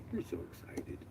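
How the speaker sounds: soft whisper to a pet. Real, not acted. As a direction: whisper it fondly. Whispering to the dog.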